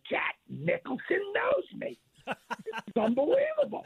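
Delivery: screaming inside with disbelief; inner starstruck thrill. Retold or imitated. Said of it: "inside I'm going like this".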